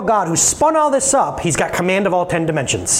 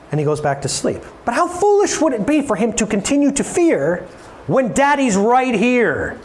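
ordinary talk

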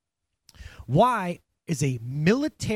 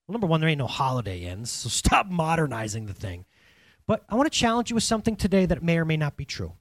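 ordinary talk

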